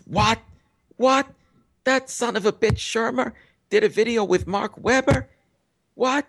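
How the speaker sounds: high, incredulous mock outrage; Rubin hitting the ceiling. Rubin learns Shermer worked with Webber, high incredulous outrage. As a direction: imitate an outraged man hitting the roof. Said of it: "hits the ceiling".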